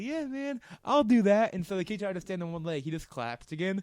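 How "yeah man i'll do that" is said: put-on high, slow, spacey drunk kid. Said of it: "the kid's like".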